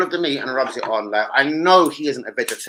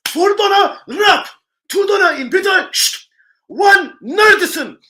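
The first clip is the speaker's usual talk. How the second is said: very high, loud, slow mock order call; shop server calling orders; 8 dB louder, slower than usual. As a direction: ham up the server barking orders.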